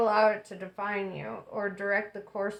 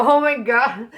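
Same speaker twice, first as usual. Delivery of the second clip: much louder, emphatic exclamation; exasperated outburst; 2 semitones higher, 13 dB louder than usual. Real, not acted. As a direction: blurt it out loudly, driving the point home. Exasperated outburst over repeated card message.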